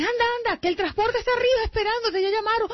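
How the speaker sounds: big sister rushing little sister to the bus; mock hurry. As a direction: hurry her out the door.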